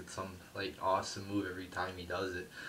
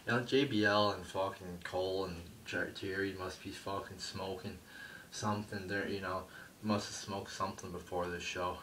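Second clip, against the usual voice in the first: ordinary delivery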